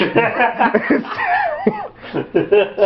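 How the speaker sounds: a laugh breaking into a shout; real laughing outburst. Real, not acted. Laughs at his own joke.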